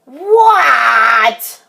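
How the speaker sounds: high, loud, drawn-out shout; high yelled reply; called-back shout. Acted. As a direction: holler it back like a kid being called. Child answers his mother's call.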